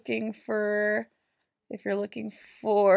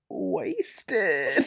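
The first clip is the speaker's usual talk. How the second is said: normal talking voice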